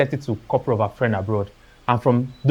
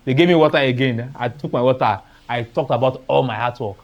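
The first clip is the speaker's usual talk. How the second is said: ordinary talk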